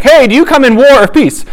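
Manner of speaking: high-pitched hail to an approaching army; messenger calling out. Retold or imitated. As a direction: imitate a messenger calling out his question. Messenger calls out to approaching commander.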